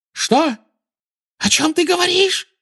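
very high, frightened squeal; shrieking. A narrator's reading. Witch panics at the boy's news, shrieking high. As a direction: shriek it in alarm. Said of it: "взвизгнула она".

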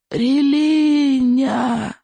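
very high, slow anguished shout; desperate shout. A narrator's reading. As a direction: shout it out in despair. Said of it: "в отчаянии выкрикнул Икинг".

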